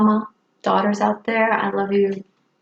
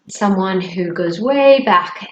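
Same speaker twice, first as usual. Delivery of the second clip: ordinary talk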